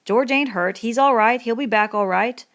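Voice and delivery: hasty reassurance. A narrator's reading. Crooks soothing threatening Lenny.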